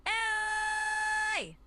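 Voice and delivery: loud, high, sustained cry; drawn-out call on one high note. Shown as a demonstration. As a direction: hold the vowel long, high and forceful. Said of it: "do that a little more".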